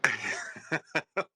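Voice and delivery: breathy high-pitched laugh; high airy laugh. Real, not acted. Host calls him OG.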